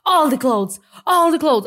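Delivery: high, loud, slow barked English command; imitated angry order. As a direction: bark the order at a mother. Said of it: "hon står och slår mig nästan".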